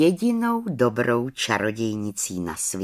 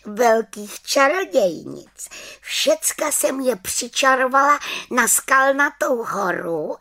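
high little witch announcing her plan; small witch voice; gleeful little witch; 8 semitones higher than usual. Acted. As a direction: pitch it high and triumphant.

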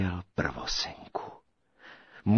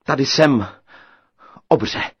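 louder, bold call to the giant; brave call; 4 semitones higher, 9 dB louder than usual. A narrator's reading. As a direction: stand tall and call out.

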